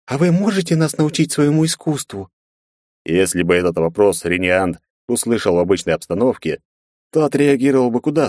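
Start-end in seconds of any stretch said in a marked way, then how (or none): 0.1-2.3 conspiratorial voice. A narrator's reading. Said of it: "заговорческим голосом".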